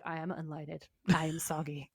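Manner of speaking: soft, whispery gloom; mock-miserable and hushed, all delight gone; deflated. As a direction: say it glumly, nearly whispered. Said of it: "Now I was like".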